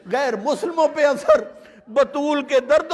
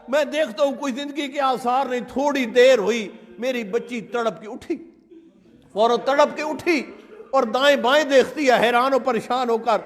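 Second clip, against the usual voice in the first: ordinary talk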